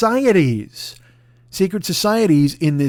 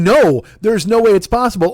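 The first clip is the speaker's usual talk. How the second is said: higher, louder incredulous protest; mock disbelief; 6 semitones higher, 5 dB louder than usual. Voicing the listener's disbelief, louder and higher. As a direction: play the stunned listener. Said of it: "You're thinking".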